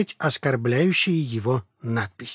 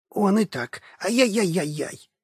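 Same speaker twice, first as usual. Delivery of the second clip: whimpering; 5 semitones higher than usual. Acted. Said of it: "Подвывая".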